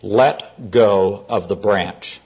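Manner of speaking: low, very slow command from above; lightly played divine voice, measured and deep; voice of the Lord. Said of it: "The voice comes back".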